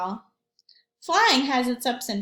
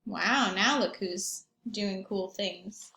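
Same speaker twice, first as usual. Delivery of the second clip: ordinary delivery, nothing marked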